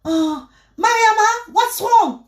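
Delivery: high, alarmed English questions from the neighbour; worried neighbour. Retold or imitated. Neighbour finding her crying asks what's wrong, high and alarmed. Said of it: "Elle dit que".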